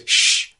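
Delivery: quick unvoiced shush; an acted "shh". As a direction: hush them on reflex. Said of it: "He just goes".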